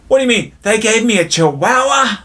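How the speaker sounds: very high, loud outraged squawk; indignant disbelief. Acted. Woman with chihuahua protests to the bartender, indignant disbelief. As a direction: shriek it as a hammed-up punchline.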